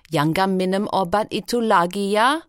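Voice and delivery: lightly voiced, friendly Indonesian instruction; grinning doctor. A narrator's reading. Indonesian doctor cautioning a small patient, lightly voiced with a grin. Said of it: "instructs the emergency room doctor, grinning".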